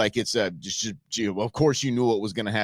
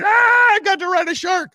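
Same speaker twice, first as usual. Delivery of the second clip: much higher, louder ecstatic yell; imitating a thrilled diver's whoop; thrilled shout; 7 dB louder than usual. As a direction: yell it like you just lived your dream. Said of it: "And he's got a demon".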